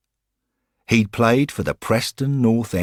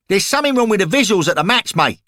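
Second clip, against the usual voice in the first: high, loud and fast lairy thug; football hooligan voice; 12 semitones higher, 6 dB louder, much faster than usual. Acted. Lamper tells Henry the match went wrong, rowdy hooligan voice. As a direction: bark it like a rowdy terrace lad.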